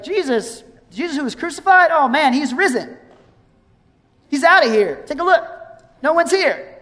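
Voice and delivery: high, lively, casual surprise; excited angel. Retold or imitated. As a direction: tell them the news with glee, a little playful. Angel announces the empty tomb.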